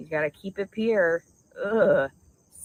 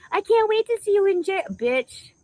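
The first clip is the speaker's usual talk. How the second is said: much higher and louder shriek; raging high-pitched yell; furious shout; 7 dB louder than usual. Real, not acted. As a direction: scream it at her. Yelling at an imagined enemy.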